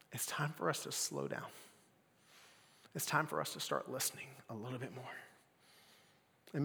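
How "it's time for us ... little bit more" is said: soft unvoiced whisper; whispered plea. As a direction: hush it like God's still small voice. Sermon on still small voice.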